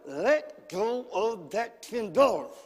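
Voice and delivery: slow, effortful tug on each word; straining God prying. Acted. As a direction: act out prying something loose as you speak. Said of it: "trying to pry that out".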